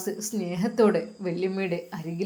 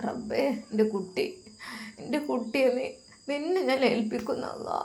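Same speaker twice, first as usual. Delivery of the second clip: sorrowful prayer; 3 semitones higher, 6 dB quieter than usual. Acted. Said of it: "സങ്കടത്തോടെയാണ് അത് പറഞ്ഞത്".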